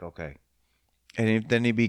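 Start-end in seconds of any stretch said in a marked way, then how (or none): 0.0-0.4 meek okay. Retold or imitated. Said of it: "he'd be like".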